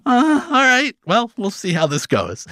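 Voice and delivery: high, wary "all right"; mock wary resignation. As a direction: shrug it off nervously.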